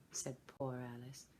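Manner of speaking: usual speaking voice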